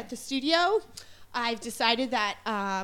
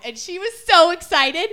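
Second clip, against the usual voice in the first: ordinary talk, nothing marked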